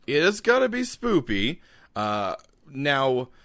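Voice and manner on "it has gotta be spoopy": playful high voice. Pretend. Echoes spoopy, high.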